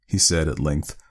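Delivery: usual speaking voice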